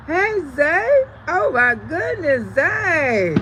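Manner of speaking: high, loud, thrilled hello; delighted greeting. Real, not acted. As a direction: greet a favorite guest with real delight. Delighted greeting as Zay joins chat.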